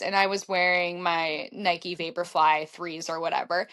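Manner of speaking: ordinary talk, nothing marked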